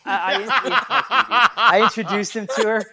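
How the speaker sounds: laughing through the words. Real, not acted. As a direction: chuckle as you speak. Laughing while telling.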